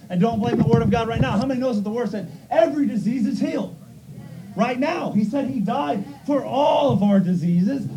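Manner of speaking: loud, high shouting sermon; hammering revival preaching; fiery preaching. Real, not acted. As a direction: preach it with full force. Preaching at full force.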